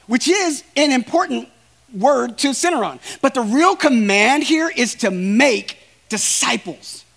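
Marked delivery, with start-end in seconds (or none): none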